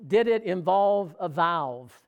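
slow, deliberate question, each word weighed; slow careful question. Retold or imitated. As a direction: ask it slowly, knowing the answer. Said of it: "I go".

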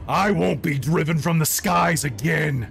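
vengeful monster's threat; roaring dragon. Acted. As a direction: roar a vow of revenge, stretching the last words. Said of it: "she roars".

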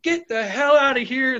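high, emphatic mock shout; angry dismissal. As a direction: imitate fed-up officers ordering someone out. Retold officers throwing them out.

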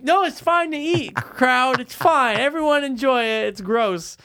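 high, airy mock voice; high mocking quote; high-pitched mimicry. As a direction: mimic them in a high, put-on voice. Mimics people in a crowd scene.

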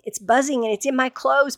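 ordinary delivery, nothing marked

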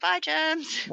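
put-on high childlike chorus of bye; kids' cheery bye. Children answering their mum at the drain, high childlike bye. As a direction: answer like a happy little kid. Kids' reply voiced by mother.

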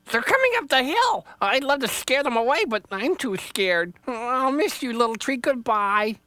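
very high, jittery little rabbit; a scared bunny saying farewell; timid rabbit voice. Acted. Rabbit says goodbye to Little Tree, very high and nervous.

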